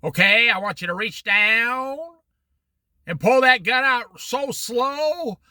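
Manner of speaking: put-on slow, drawn-out hillbilly accent with a shouted middle; a Western outlaw-movie hillbilly accent, taking his time. An outlaw holding a gun in a Western, in a hillbilly accent. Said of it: "Wow, that's an accent".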